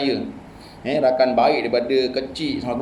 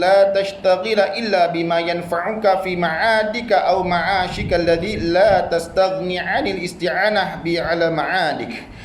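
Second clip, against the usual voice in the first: measured, lifted reading of the Arabic text; classical Arabic recitation; 3 semitones higher than usual. Acted. Teacher reciting the Arabic text to his students, in a chant-like lilt.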